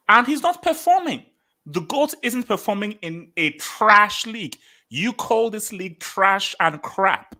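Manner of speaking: high, loud, worked-up rant; heated rant. Real, not acted. Streamer ranting at chat about the trash league, high and heated. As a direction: hammer the point at the chat, pitch up.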